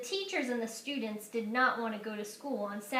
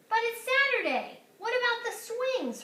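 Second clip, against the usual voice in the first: put-on much higher, louder little-boy protest; small brother protesting; brother calling; 11 semitones higher, 5 dB louder than usual. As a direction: call after her, dismayed. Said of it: "her brother called".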